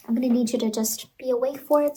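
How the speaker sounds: ordinary talk, nothing marked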